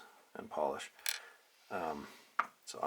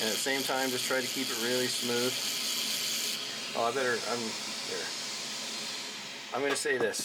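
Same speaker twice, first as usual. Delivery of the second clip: raised, higher voice over a droning vacuum; talking up over the machine noise; 4 semitones higher than usual. Real, not acted. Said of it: "you'll have to excuse the vacuum noise".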